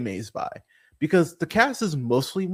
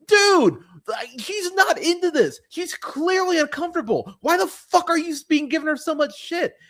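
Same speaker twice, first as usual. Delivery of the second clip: much higher, pleading exasperation; outraged disbelief; 13 semitones higher, 3 dB louder than usual. Real, not acted. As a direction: go way up in pitch, incredulous, at the dude. Outraged at the shitty boyfriend.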